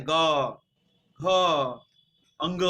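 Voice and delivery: ग, घ, अंग spoken one by one with extra force; forceful voiced letters. Shown as a demonstration. Teacher shows students the voiced letters, each spoken with force. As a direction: give each of ग, घ, अंग a strong voiced push. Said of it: "ऐसा जब हम उच्चारण करते हैं तो इसमें ज्यादा जोर पड़ता".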